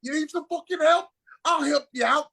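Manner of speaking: high-pitched, worked-up Cockney; crazy Cockney accent. Retold or imitated. Drunk friend offering the struggling driver help, crazy Cockney accent. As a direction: mimic a loud Cockney friend butting in. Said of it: "his crazy Cockney ass accent".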